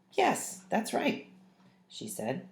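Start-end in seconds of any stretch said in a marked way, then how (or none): 0.1-1.3 slow, high, drawn-out surprised yes. A narrator's reading. Mum surprised her husband remembered everything. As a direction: answer in slow, surprised disbelief. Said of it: "my mum was surprised".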